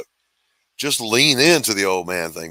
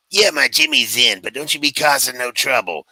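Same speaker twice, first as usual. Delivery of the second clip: higher, louder put-on granny; pitched-up impression of a four-foot granny; little granny voice; 5 semitones higher, 4 dB louder than usual. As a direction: imitate a tiny old granny laying down the law. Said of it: "a little bit higher pitched voice".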